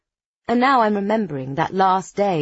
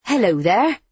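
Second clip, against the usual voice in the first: put-on West Country farm-girl greeting; hammed-up West Country lilt; broad West Country accent; 2 semitones higher, 4 dB louder than usual. A narrator's reading. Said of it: "in my broadest West Country accent".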